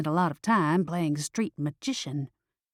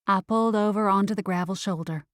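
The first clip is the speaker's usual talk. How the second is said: usual speaking voice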